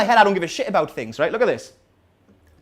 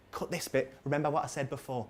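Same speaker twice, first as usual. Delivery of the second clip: much softer, lower aside; hushed aside; 6 semitones lower, 13 dB quieter than usual. Pretend. Comedian asking for this bit to be cut, hushed aside. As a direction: drop right down, as if off the record.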